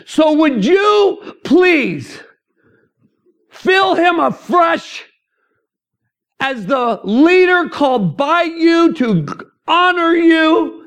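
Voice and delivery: high, loud, slow fervent prayer; wife praying fiercely for her husband over the phone; Navy seal preaching. Retold or imitated. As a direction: pray over him like a drill sergeant preacher. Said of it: "gave me this Navy seal preaching".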